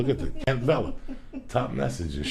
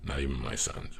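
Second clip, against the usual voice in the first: ordinary delivery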